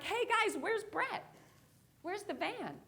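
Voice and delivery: mock-innocent query to the guys; innocent question. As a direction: ask the group in a high, clueless voice. Acted cheerful question to teammates.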